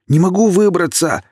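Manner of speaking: high, loud panicked cry; fear in voice. A narrator's reading. Kirill crying out in fear as he sinks. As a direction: yell it as the panic sets in. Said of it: "услышала страх в голосе Кирилла".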